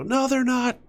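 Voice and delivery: mock protest. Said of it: "Watch someone go".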